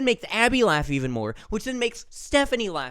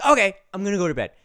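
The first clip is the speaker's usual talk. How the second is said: put-on quick, louder, higher child quote; child's announcement; 4 semitones higher, 7 dB louder, much faster than usual. Four-year-old announces she's going to bed, lightly voiced child. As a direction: toss it out fast and loud, imitating a child.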